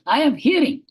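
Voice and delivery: retold indignant reply, pitched right up; protesting visitor. Visitor answers the teacher who corrected him, protesting.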